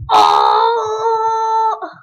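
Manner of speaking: high, loud cries; tense shouting. Real, not acted. Gamer nearing the end of a level, tense shouting. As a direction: yell through the last jumps.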